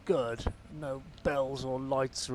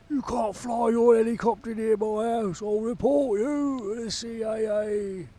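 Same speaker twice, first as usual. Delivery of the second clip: much higher, louder mock Kent local accent; 10 semitones higher, 6 dB louder, slower than usual. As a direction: lampoon the angry Kent neighbour in his local accent. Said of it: "They talk like that down here".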